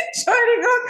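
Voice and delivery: high loud laughing, apology through laughter; burst of laughter. Real, not acted. Guest asked her age, bursting out laughing.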